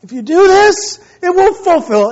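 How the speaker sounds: put-on high, loud, earnest kid; imitated charismatic passion. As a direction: plead your case to Dad like a little orator. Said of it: "he's very charismatic".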